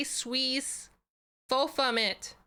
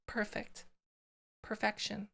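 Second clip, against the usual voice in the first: ordinary delivery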